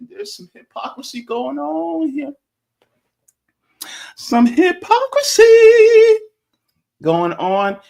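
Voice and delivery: hypocrisy drawn out very high and loud; dramatic emphasis. Acted. Host teasing a story to chat, hypocrisy stretched high and loud. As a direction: land the word hypocrisy like a punchline.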